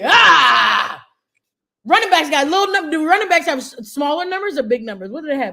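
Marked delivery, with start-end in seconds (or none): 0.0-1.0 hype shout. Pretend. Hyped about jersey number.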